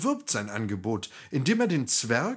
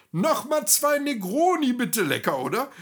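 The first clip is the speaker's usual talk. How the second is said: much higher, louder shout across the room; Brockmüller calls out for two more Negronis, loud and cheerful; calling an order; 13 semitones higher, 5 dB louder than usual. A narrator's reading. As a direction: call the waiter over the noise. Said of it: "rief Brockmüller in den Raum".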